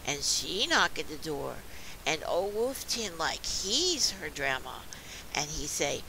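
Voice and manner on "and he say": plain reading voice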